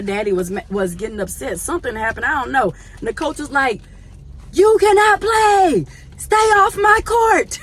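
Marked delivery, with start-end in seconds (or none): 4.5-7.6 high, loud, slow yell; angry shout. Retold or imitated. Coach ordering the dad off the court, shouting. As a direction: bark the order like a furious coach.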